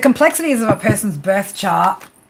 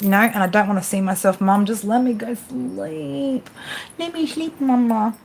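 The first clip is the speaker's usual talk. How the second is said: voicing the cat; 4 dB quieter than usual. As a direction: play the grumpy sleepy cat. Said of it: "He's like".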